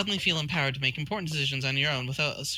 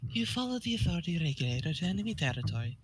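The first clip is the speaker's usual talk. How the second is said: rolled R's; 4 dB quieter than usual. Acted. Narrator sending player into enemy territory, rolled R's. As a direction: roll the R's for fun. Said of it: "begun to roll your R's".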